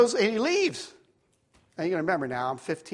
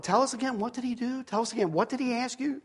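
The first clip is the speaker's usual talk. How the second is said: lightly voiced higher-pitched eager questioners; imitated curious classmates; 5 semitones higher, 4 dB quieter than usual. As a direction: press for the story in a raised pitch. Voices classmates pressing for story.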